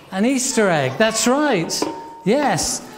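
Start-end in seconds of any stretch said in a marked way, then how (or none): none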